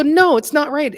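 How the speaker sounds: high, firm correction; a high-pitched no, that's wrong; correcting friend. Retold or imitated. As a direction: play the friend who won't accept it. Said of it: "she go".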